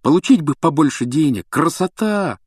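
high, excited daydreaming aloud; eager and pitched right up; fired up. A narrator's reading. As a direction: revel in the thought, voice soaring. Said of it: "загорелся Рон".

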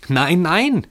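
high-pitched interjected cry; woman crying out. A narrator's reading. Woman in the crowd contradicting the others, high cry. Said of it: "schrie eine Frau dazwischen".

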